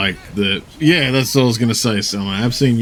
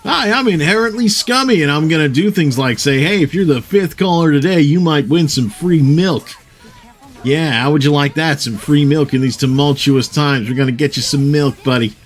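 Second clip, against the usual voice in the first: higher, hammed-up sleazy radio DJ; morning radio host; 5 semitones higher than usual. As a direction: pitch it like a cheesy breakfast-show host. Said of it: "sounded like a morning radio show guy".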